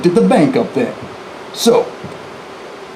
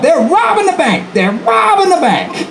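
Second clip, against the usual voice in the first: yelled alarm; 11 semitones higher than usual. Retold or imitated. Bank clerk at the window alerting the street, high yell. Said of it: "yelled out".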